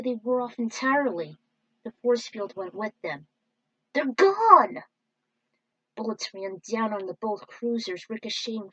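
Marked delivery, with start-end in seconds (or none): 3.9-4.8 high, loud panicked yell; alarmed cry. Acted. Crew member crying out as the shields vanish, alarmed. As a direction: shout that the shields are down.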